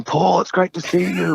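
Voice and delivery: high, put-on delighted voice; imitated effusive greeting. Drummer hugging the wrong man, imitated gushing. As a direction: greet an old friend in a high pitch.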